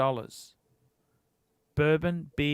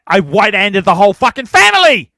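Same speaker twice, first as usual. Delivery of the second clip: fired-up loud boast; 2 semitones higher, 10 dB louder than usual. Real, not acted. Bragging to Jamie about beating the family, loud fierce boast. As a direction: crow about it, full volume and fierce.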